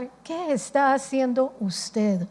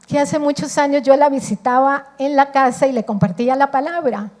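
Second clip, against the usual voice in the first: ordinary delivery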